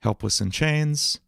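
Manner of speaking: "Helpless in chains" tried slowly as a lyric, chains held high; emphasis on wrong syllable. Shown as a demonstration. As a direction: test the fit of "Helpless in chains", drawing out chains. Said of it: "the emphasis on the wrong syllable".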